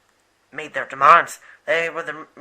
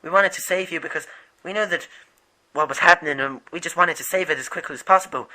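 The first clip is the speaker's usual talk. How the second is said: normal talking voice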